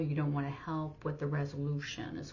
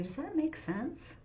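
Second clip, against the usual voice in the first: high, sing-song mock question; hammed-up high sing-song; 11 semitones higher than usual. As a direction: mimic the phrase to drop, teasingly. Mocking the phrase to avoid.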